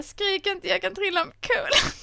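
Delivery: put-on high, whiny little boy plea; boy in the film. Boy begs Anna not to shout, high whiny plea. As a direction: beg her not to shout, high and small. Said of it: "han bara".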